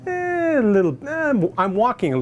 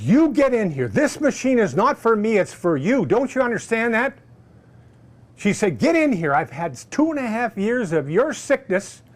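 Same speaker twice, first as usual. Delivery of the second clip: insistent; 6 semitones higher, 3 dB louder than usual. Retold or imitated. Wife insisting he come in.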